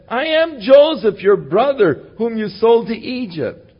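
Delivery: high, loud, choked-up declaration; emotional reveal. Acted. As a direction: cry out who you are to your brothers. Joseph reveals himself to brothers.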